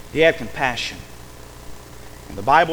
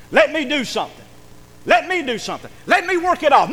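loud, high plea, building; acted son's urgent appeal; insistent pleading; 9 semitones higher, 6 dB louder than usual. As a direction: beg hard to be allowed. Son begs father to let him work.

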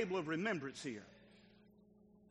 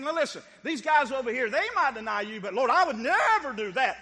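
boastful Peter; 6 semitones higher, 12 dB louder than usual. Retold or imitated.

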